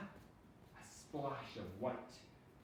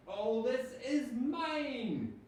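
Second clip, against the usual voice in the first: slow, loud triumphant shout; 12 semitones higher, 8 dB louder, much slower than usual. A narrator's reading. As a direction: shout it at the wind, claiming everything. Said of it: "he shouted at the wind".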